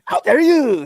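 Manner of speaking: high, loud hammy French voice; loud high mock French accent; mock French outrage. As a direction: play an offended Frenchman. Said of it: "You sound French as fuck".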